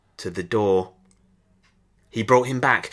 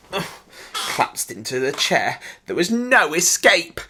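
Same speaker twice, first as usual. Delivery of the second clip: ordinary delivery, nothing marked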